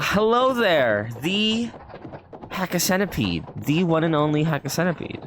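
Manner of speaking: a hammed-up welcome for a viewer; mock announcer. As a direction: introduce them like a game-show host. Greeting a viewer, announcer style.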